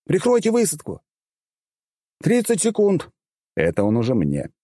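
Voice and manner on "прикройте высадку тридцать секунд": high, urgent military callout; pilot's combat call. A narrator's reading. Pilot warns of landing in seconds, high urgent callout. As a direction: call it out high over the comms.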